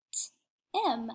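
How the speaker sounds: "T-im" split as a crisp T, pause, then IM; segmented word. Shown as a demonstration. Teacher helping pupils hear the middle sound, segmented. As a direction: sound out "T-im" in two separate pieces. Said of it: "let's segment that".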